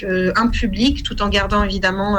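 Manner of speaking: ordinary talk, nothing marked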